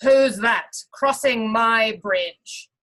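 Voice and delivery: loud, slow troll bellow; great big troll voice. Acted. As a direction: boom it out like a troll guarding his bridge. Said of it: "the troll called out in a great big voice".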